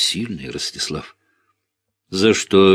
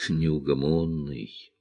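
fond; 4 semitones lower, 8 dB quieter, slower than usual. A narrator's reading. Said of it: "произнесла она".

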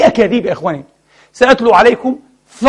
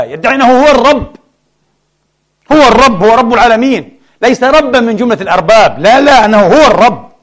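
هو pressed high and hard, again and again; stress on هو; 5 semitones higher, 4 dB louder than usual. Acted. Preacher to congregation, stressing هو with scorn. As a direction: hammer the word هو. Preacher mocking the false god's claim.

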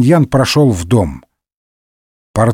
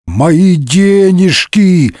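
high, slow anguished howl; bear-like bellowing wail; 6 semitones higher, 3 dB louder, much slower than usual. A narrator's reading. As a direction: bawl it out, dragging each word. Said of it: "медведем заревел Портос". (протяжный горестный рёв)

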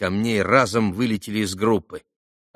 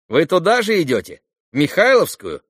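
higher, louder schoolboy voice; boy asking; 8 semitones higher, 7 dB louder than usual. Acted. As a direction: call the question out like a boy. Boy questions Alyosha. (громкий мальчишеский вопрос)

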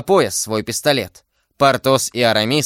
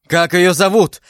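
urgent, higher-pitched exclamation; crying out; 6 semitones higher, 4 dB louder than usual. A narrator's reading. D'Artagnan demanding the woman's name, crying out. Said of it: "вскричал Д'Артаньян".

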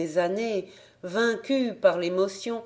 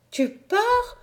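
much higher, disbelieving; high alarmed question; 14 semitones higher than usual. A narrator's reading. Little sister asking again, high and disbelieving. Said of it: "reprit-elle".

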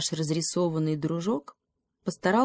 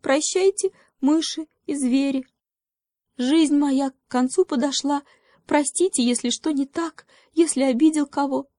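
high-pitched mouse saying farewell; a small, high mouse bidding the world goodbye; high little mouse voice, last words; 8 semitones higher than usual. A narrator's reading. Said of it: "подумал мышонок".

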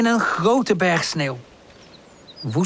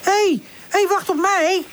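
calling out; 13 semitones higher than usual. Acted. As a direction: shout after your friend to wait. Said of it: "roept ze".

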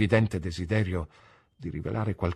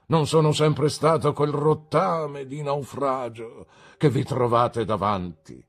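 slow, higher self-pity; mock-tragic lament; 6 semitones higher, 5 dB louder than usual. A narrator's reading. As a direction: play the ruined gentleman. Micawber laments his ruin. (Micawber, melodrammatico e lento)

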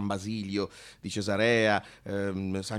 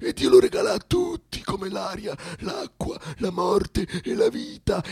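much higher, shouted preaching; preaching at full force. Acted. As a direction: proclaim it from the pulpit. Preaches that time is God's. (predica gridata con tutta la forza)

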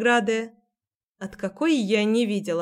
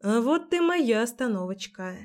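plain reading voice

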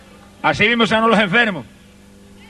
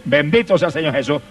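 ordinary talk

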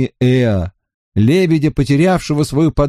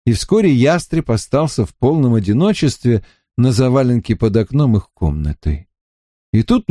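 plain reading voice

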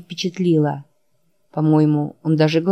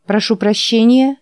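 surprised; 5 semitones higher than usual. Acted. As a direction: caught off guard, indignant. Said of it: "удивилась я".

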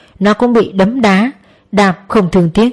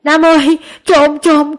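high, louder alarmed cry for help; calling out loud; 7 semitones higher, slower than usual. A narrator's reading. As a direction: shout to wake the grandson, panicked. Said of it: "Bà ngoại vội kêu lớn". (kêu lớn hoảng hốt, giọng cao)

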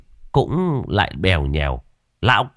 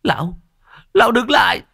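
much higher, loud pleading shout; desperate refusal; 4 dB louder than usual. Acted. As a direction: beg him to stop, refusing outright.

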